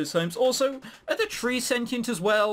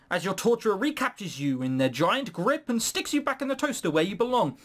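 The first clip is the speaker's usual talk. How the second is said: usual speaking voice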